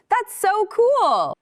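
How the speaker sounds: high slow gushing. Acted. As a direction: coo it like talking to a puppy. Gushing over doggies.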